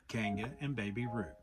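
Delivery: plain reading voice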